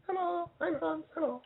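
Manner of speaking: high, soft squeaky little German voice; little German listener. Retold or imitated. Host voicing a tiny German listener, high and small. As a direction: play a tiny German fan saying hi. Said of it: "the little German that you know".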